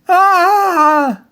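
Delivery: sudden high yelp; a startled yelp; yell. A narrator's reading. Father bitten on the nose by the baby, yelping loud and high. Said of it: "He yelled".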